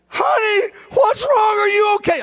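high, loud frantic cry; retold panicked shout of a frightened wife. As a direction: mimic a wife crying out in panic. Wife panics as husband chokes.

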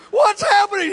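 high loud panicked cry; mock a frightened wife shouting; retold frantic question. Wife panics as husband chokes.

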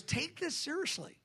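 earnest plea. Retold or imitated. Jesus urging the church, high and soft. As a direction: lift it high and soft, pleading. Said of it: "but he goes".

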